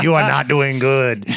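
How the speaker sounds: a slowish, lightly put-on Muppet-ish scold; tut-tut someone in a goofy puppet voice. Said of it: "I don't know what voice it is".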